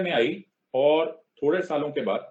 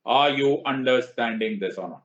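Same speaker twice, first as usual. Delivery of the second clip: slow, louder classroom question; drawn-out question; 4 dB louder, much slower than usual. Shown as a demonstration. Teacher quizzing his students, slow and pointed. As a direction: stretch it out to make the class answer. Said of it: "teacher checks the class".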